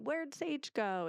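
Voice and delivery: high, slow sing-song seeking; mock-searching like in a game; playful dad. Mother retelling dad teasing the child, high slow game voice.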